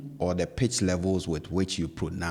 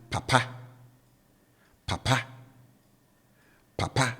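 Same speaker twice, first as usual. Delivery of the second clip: "papa" low first syllable, raised last syllable; low-high tone; 5 semitones higher, 3 dB quieter than usual. Shown as a demonstration. Teacher showing learners the third Twi word, low-to-high tone on "papa". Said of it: "tone on the first syllable is down".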